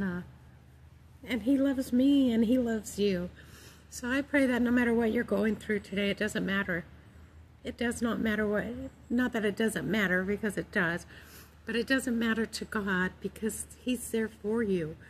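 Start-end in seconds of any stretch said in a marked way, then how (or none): none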